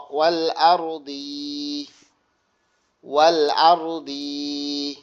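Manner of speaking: slow Quranic recitation, long held vowels; jazm reading demo. Shown as a demonstration. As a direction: recite word by word, stretching each ending. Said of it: "এটা গেল জজমের পড়া". (জজমের পড়া, ধীরে টেনে টেনে তিলাওয়াত)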